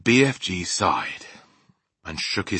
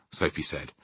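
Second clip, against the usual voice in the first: ordinary delivery, nothing marked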